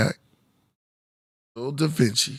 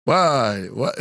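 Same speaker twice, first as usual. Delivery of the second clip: normal talking voice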